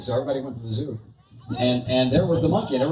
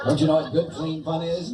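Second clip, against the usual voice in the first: ordinary talk, nothing marked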